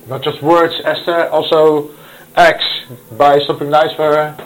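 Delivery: voicing the Lord urging her to act, not just talk; the Lord insisting. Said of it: "And the Lord just said".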